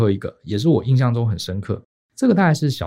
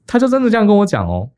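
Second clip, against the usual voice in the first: laughing; 10 semitones higher, 4 dB louder than usual. Real, not acted. Laughing in disbelief at the aunt.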